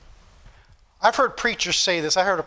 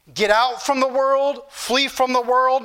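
slow, higher-pitched preacher's urging; preaching exhortation; 4 semitones higher, slower than usual. Real, not acted. Pastor telling his congregation to flee the world, slow exhortation. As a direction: urge the congregation, drawing out each phrase. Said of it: "I'm here to tell you this morning".

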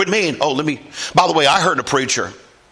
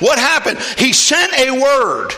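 full-force preaching; 9 semitones higher than usual. Acted. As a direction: drive the story home from the pulpit. Preaching Naaman story.

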